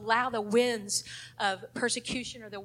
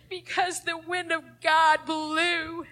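tearful, slow; 5 semitones higher, 4 dB louder, slower than usual. Real, not acted. Tells of her father's death.

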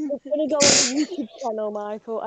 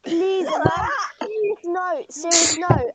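higher, raised pleading; urgent pleading; 3 semitones higher, 6 dB louder, slower than usual. Real, not acted. Friend begging the others to drop the name, pleading. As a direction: beg them to stop.

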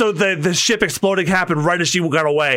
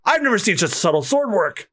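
higher take, near the usual voice; lighter reading; 5 semitones higher than usual. Acted. Onlooker admiring the sword work, lighter voiced. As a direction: read it in a lighter voice. Line first read, then redone.

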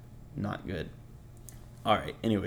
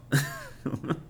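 airy laugh; 5 semitones higher than usual. Real, not acted. Laughs at own remark.

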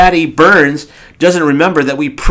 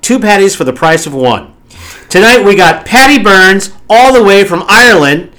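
radio host intro; 6 semitones higher, 6 dB louder than usual. Acted. Host hypes the guest intro.

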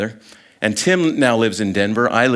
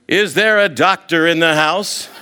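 raised, playful call across a crowd; mock yell; 6 semitones higher, 3 dB louder than usual. Friend calling across the airport, playful raised yell. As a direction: call it out to the whole terminal, half joking. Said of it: "he starts yelling in the Phoenix airport".

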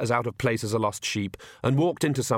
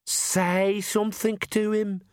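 inner scream; 10 semitones higher, slower than usual. A narrator's reading. Inner voice urging Agnes to speak, muted scream. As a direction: scream it inwardly, pitched up and slow. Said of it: "Perdita screamed within".

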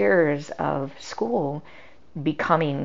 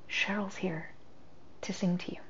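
soft hush; 16 dB quieter than usual. Retold or imitated. Wife's gentle words to dying husband.

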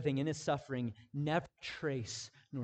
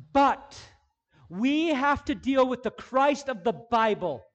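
louder, higher, driving sermon delivery; full-force preaching; 9 semitones higher, 12 dB louder than usual. Acted. Pastor urging the congregation on, full-force preaching. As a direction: preach it hard to the room.

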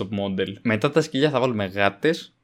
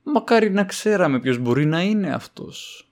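usual speaking voice

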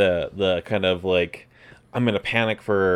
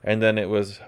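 ordinary talk

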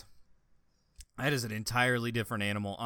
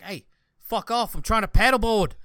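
loud, higher, irritated shout at the snake; mock annoyed yell; 10 semitones higher, 9 dB louder than usual. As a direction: shoo off a pest. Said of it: "he's just like".

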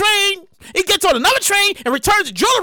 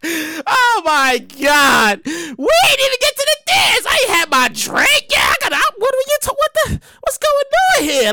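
mock wail; 6 semitones higher than usual. Guest wailing that he never got his drink. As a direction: whine about the missed party. Guest laments missed party.

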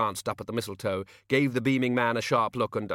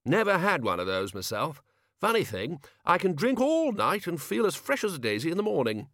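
higher, boastful wizard; hearty old wizard; 8 semitones higher than usual. A narrator's reading. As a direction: brag it cheerfully. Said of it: "said Ridcully".

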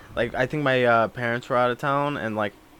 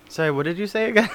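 quick, higher mock-innocent question; feigned confusion; 6 semitones higher, 4 dB quieter, faster than usual. Prankster asking scam caller to repeat, feigned confusion. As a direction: play innocent, lightly and fast.

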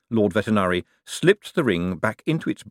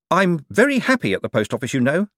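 raised, a little defensive; 9 semitones higher, 4 dB louder than usual. A narrator's reading. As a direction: protest a bit too quickly. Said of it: "realised that he sounded defensive".

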